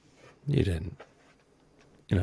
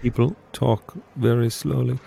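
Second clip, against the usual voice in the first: Werner Herzog; 3 semitones higher than usual. Pretend. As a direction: draw it out in a deliberate German director's accent. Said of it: "this is my Werner Herzog".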